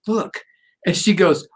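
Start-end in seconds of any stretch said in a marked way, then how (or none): 0.1-0.4 soft reveal look. Retold or imitated. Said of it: "rolled it over and went".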